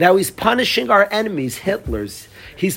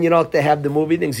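ordinary talk, nothing marked

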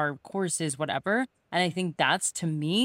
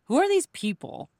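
higher-pitched quoted challenge; imitating someone's disbelief; mock incredulous question; 8 semitones higher than usual. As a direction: lift it like a puzzled demand.

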